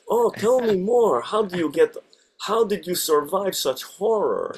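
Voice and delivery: put-on high, slow, loud Russian accent. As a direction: drawl it out in thick Russian. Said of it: "I get to do ... a Russian accent".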